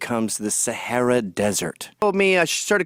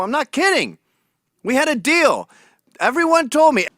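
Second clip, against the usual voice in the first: higher whiny protest; mock indignant whine; 10 semitones higher than usual. Comic retelling his indignant protest to Trump, whiny and high. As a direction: plead like a betrayed partner. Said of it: "I go".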